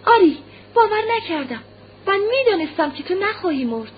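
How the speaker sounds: put-on very high little-boy voice, emphatic; insistent child. A narrator's reading. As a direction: assure mother with a child's certainty. Child assures mother of his faith. (با صدای زیر و اطمینان کودکانه)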